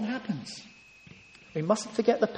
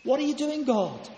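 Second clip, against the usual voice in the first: high, pained cry of bewilderment; a hint of a desperate believer; anguished question to God; 6 semitones higher than usual. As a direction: demand an answer from heaven. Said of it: "He cries out".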